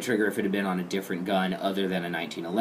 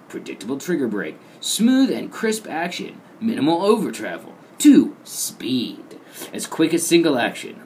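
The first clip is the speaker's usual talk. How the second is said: melodramatic voice; 4 semitones higher than usual. Acted. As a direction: read the brochure like a soap opera. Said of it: "pardon the melodramatic voice".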